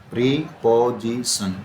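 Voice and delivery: Preposition slowly, as pre, पो, जी, सन; syllable by syllable. Shown as a demonstration. Teacher showing four syllables to students, preposition in slow separated pieces. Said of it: "इसमें four syllables हैं".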